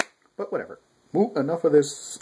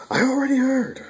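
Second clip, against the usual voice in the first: put-on much higher, flat girl voice; 3 dB louder than usual. Voicing Shiranui as she cuts them off, high-pitched.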